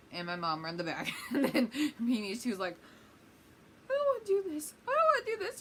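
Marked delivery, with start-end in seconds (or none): 3.9-5.6 very high, loud mock wail; hammed-up squealing protest; terrified little sister. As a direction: imitate a panicking little kid. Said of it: "my poor little sister Mimi is terrified".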